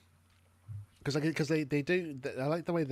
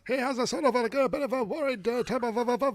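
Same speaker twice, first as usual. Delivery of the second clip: worried voice pitched up high; Sancho impression; 11 semitones higher, 8 dB louder than usual. Reviewing a cartoon, imitates Sancho's worried voice. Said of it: "my impression of, of, uh, Sancho".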